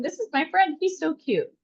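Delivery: put-on high little-girl voice, quoting fondly; imitating proud toddler. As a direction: show off a beloved toy like a small child. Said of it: "carrying the dinosaur around going".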